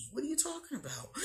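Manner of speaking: laughing. Real, not acted. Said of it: "teasing herself, laughing".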